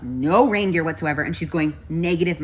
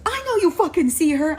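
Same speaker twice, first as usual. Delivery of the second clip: high, loud retold yell; angry confrontation; 11 semitones higher, 5 dB louder than usual. Woman banging on the bus at the driver, angry yell. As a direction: imitate a furious bystander challenging a driver.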